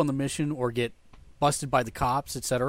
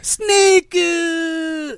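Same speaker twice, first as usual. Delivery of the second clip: long, high, loud wail of the name; game death-scream impression; 14 semitones higher, 7 dB louder than usual. Friend teased about going back to Snake, mock-anguished scream. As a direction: cry it out, drawn out and mock-anguished. Imitates game's cry for Snake.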